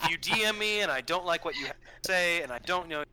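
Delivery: normal talking voice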